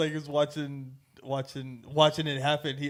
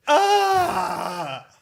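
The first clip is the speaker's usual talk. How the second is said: sudden high crying shout, half laughing; pained cry; 12 semitones higher, 4 dB louder than usual. Real, not acted. Fan recalling Hughes' death, cries out in a high wail. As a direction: let out a pained wail at the memory.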